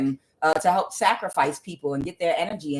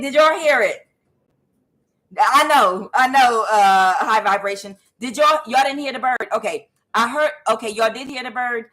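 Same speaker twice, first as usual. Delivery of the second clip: normal talking voice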